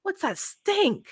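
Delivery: high, slow, drawn-out bafflement; mock disbelief. Tenant reacting to the possum stench under the rental, mock disbelief. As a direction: recoil at a bad smell, pitch climbing.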